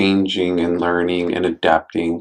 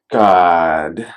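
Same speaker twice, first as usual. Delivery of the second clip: put-on voice; 4 semitones lower than usual. Real, not acted. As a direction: drop into a deep voice for effect. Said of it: "say it in that voice".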